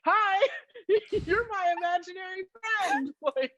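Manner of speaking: high, giggling, awkward hello; laughing nervous greeting. Pretend. Fan rehearsing how to greet her idol, giggling high. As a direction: squeak it out through laughter, mortified.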